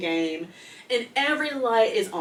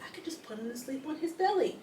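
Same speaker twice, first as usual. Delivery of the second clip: higher, lightly voiced mother, breezy; imitating her mom; 5 semitones higher, 5 dB quieter, faster than usual. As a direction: mimic mom's casual confidence. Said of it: "she was like".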